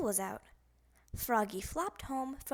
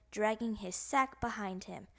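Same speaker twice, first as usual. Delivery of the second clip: usual speaking voice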